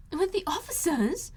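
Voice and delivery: high, slow, thrilled question; excited cry. A narrator's reading. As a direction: gasp it out with delight. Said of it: "cried Lydia".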